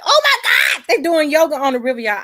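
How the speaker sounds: high, loud, fast burst of joy; thrilled yelp of delight; overjoyed squeal. Retold or imitated. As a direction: shriek it like the best news ever. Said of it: "I got so happy".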